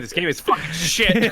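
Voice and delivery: loud, high-pitched joking rant; mock blunt outburst. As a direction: blurt out a comic verdict at full volume. Joking outburst after promising nice comments.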